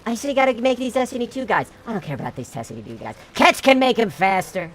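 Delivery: a hammed-up Trump ramble; Trump impression. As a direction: stammer and bluster like Trump on a rant. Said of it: "And they say".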